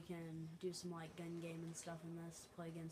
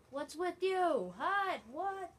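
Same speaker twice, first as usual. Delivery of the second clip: high, loud, drawn-out protest; indignant shout; 11 semitones higher, 12 dB louder, much slower than usual. Real, not acted. Player protesting at an opponent in game, indignant shout. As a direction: yell it in disbelief, pitch way up.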